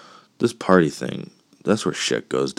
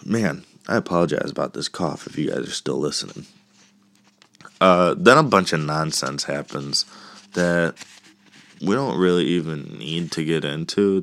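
normal talking voice

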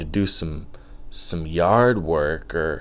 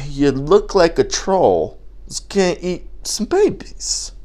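high, strained silly voice; put-on troll insult; 9 semitones higher than usual. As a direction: jeer in a put-on creature-like voice. Acts out the baby-eating troll insult.

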